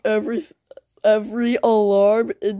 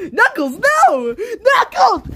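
panicked shout; 11 semitones higher, 4 dB louder, slower than usual. Acted. Panicked friend calls out.

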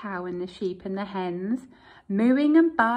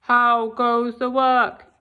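lazy farmer calling; 9 dB louder, slower than usual. Acted. Lazy farmer calling from bed to the duck. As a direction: call down lazily from bed.

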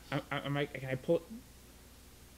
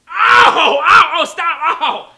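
pained yelling; 12 semitones higher, 16 dB louder, much slower than usual. Real, not acted. Pained yells at ear piercing.

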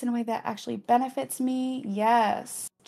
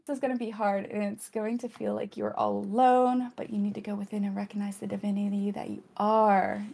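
ordinary talk, nothing marked

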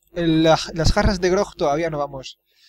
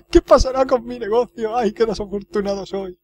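hammed-up self-pitying wail; weeping cook; 5 semitones higher than usual. Cook lamenting his business, in mock weeping. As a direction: start high and whine it like a mock sob. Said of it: "Está llorando, pobre cocinero".

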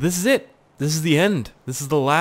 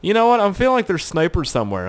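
ordinary talk

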